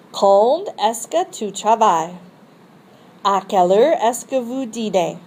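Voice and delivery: Quand and À quelle heure lifted, then falling; rise on question word. Shown as a demonstration. Teacher showing question intonation to students. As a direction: raise the voice on Quand, then let it fall. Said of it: "your voice rises on the interrogative expression".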